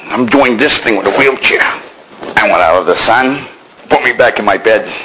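usual speaking voice

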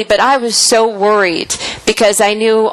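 ordinary talk, nothing marked

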